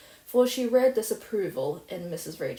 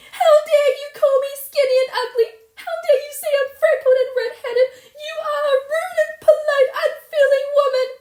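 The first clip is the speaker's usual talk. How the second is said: fast, shrieking high and loud through tears; a wounded child screaming back; raging tearful outburst; 13 dB louder, faster than usual. Acted. Insulted orphan girl raging at the neighbour, tearful shrieking.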